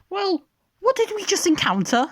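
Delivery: very high squeaky retort; high indignant voice. Acted. Dippy answering Julie, high squeaky indignant retort.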